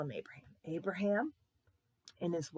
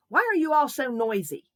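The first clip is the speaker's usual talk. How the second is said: much louder, high-pitched crabby complaint; cranky scolding; 6 semitones higher, 11 dB louder than usual. Acted. Mean lady to boys at her door, cranky scolding. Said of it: "Miss Baker's always a little bit cranky".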